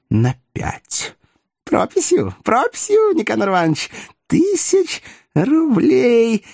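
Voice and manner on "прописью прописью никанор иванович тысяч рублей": high, put-on prompting voice; insistent fussy fixer. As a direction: prompt insistently, high, stretching the last words. Fixer prompts chairman writing receipt. (настойчиво, высоким суетливым голосом)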